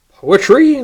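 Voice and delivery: high, loud scoffing Poetry. A narrator's reading. Little John scoffing at the dandy's poetry, high and loud. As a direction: sneer the word like it's a joke. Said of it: "Little John scoffed".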